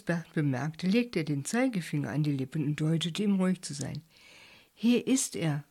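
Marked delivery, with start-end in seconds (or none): none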